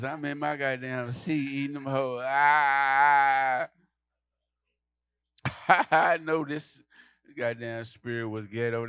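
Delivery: normal talking voice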